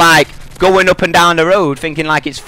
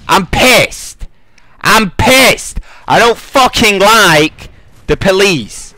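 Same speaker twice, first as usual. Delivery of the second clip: loud, raised, genuinely fed up; angry shout; 5 semitones higher, 3 dB louder, much slower than usual. Real, not acted. Ranting to viewers about the police officer, a real angry shout. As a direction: let the anger burst out twice.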